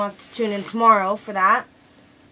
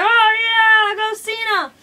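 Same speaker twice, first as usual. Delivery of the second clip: much higher, loud on the oh yeah; cheering; 10 semitones higher, 5 dB louder, slower than usual. Retold or imitated. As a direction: cheer your favourite on. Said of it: "And I'm like".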